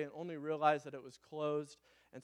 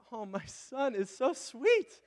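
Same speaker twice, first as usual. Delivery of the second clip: doting; 8 semitones higher, 3 dB louder, slower than usual. Retold or imitated. Dad melting at son's request.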